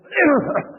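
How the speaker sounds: a high, forced clearing of the throat; mock cough to cover a sound; staged little throat clear; fake throat clearing. Shown as a demonstration. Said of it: "उसी time पर खांसते थे".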